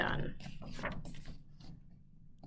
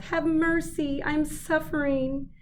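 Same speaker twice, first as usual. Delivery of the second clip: 1 wailing plea; 9 semitones higher, slower than usual. A narrator's reading. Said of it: "the fox wailed".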